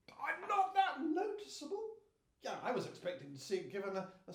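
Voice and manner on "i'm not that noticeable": high-pitched incredulous echo; indignant disbelief. Acted. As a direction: throw it back, high and scandalised. Indignantly repeats Prince Charming's objection.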